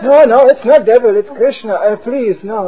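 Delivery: high, loud pleading protest; high, loud, flustered denial; frantic protest. Retold or imitated. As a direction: plead loudly and desperately. Preacher protests in panic.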